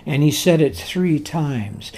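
normal talking voice

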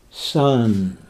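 soft, loving single word; tender. Shown as a demonstration. Preacher giving Jesus's word to the paralytic, tenderly soft. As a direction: say it as a mother would to a nursing child. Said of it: "it's full of compassion".

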